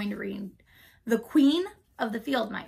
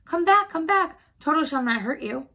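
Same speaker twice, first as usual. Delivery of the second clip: high, loud call after them; 6 semitones higher, 7 dB louder than usual. A narrator's reading. Woodsman calls to the scampering mice, calling out. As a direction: call them back from a distance. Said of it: "he called to the mice".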